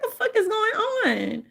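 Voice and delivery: high, startled retold cry; high-pitched mock disbelief; shocked. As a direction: sound stunned by what you just walked into. Retold shock at a police raid.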